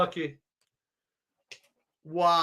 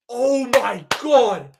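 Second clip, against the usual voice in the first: amazed; 3 semitones higher, 5 dB louder, much slower than usual. Real, not acted. Amazed at great group.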